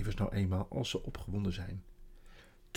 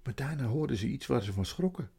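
ordinary delivery

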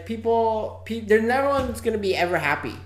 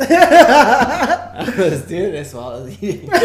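slow, louder, breaking into laughter; laughing through it; 8 dB louder, slower than usual. Real, not acted. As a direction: try to keep a straight face and fail. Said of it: "Just say it to me again. With a straight face".

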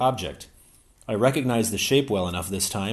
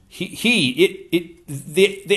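stammering; 9 semitones higher, 6 dB louder, slower than usual. A narrator's reading. Lewis stammering in alarm.

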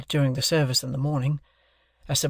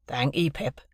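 Joe's voice. A narrator's reading. Joe voiced in read novel.

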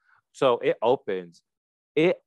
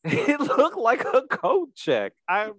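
laughing; 11 semitones higher than usual. Real, not acted. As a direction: laugh it out, baffled. Laughing at the coat check.

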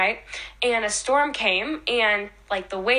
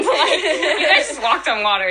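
higher and louder, laughing through it; amused laughing outburst; 5 semitones higher, 6 dB louder than usual. Real, not acted. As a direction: laugh at the absurd miracle. Laughing at apostles' reaction.

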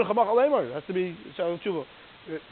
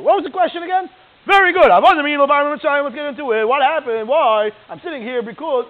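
high, very loud, enthusiastic storytelling; big, loud show of enthusiasm for a child; acted excited parent; 8 semitones higher, 12 dB louder than usual. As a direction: launch into the answer at full voice, pitched up. Acted parent reprises child's question, answers.

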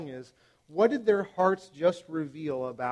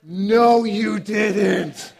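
louder, higher indignant retort; mock outrage; 4 semitones higher, 10 dB louder, much slower than usual. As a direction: shoot back like shocked parents. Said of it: "we're like".

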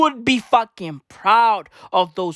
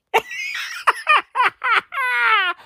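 squealing high laugh. Real, not acted. Laughing at the protester with a coal stove, squealing laugh.

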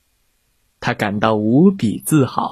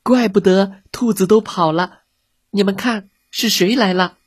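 frightened cry; 7 semitones higher than usual. A narrator's reading. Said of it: "吉恩脸色苍白地喊道".

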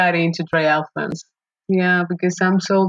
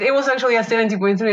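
ordinary talk

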